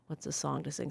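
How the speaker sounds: whispered aside to herself. Real, not acted. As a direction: whisper under the breath.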